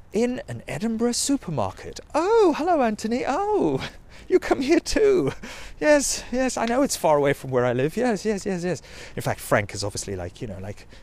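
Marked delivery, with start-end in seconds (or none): 2.1-8.8 faux-surprised greeting. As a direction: play it coy, squealing hello. Mimics woman meeting Anthony, supermarket.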